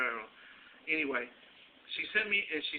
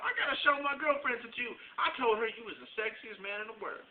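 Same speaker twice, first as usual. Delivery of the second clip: higher, lightly voiced excited lady; a touch of a gushing coworker; mock coworker voice; 7 semitones higher than usual. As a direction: coworker bragging to her friend, lightly mimicked. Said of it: "she told me".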